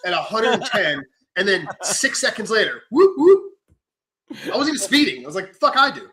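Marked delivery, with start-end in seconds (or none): 2.9-3.5 high loud whoop-whoop siren; imitated siren. Telling how cops chase him, siren imitation. 5.5-6.1 high loud curse; mock dismay. Driver seeing the cop lights, mock dismay. Retold reaction to being pulled over.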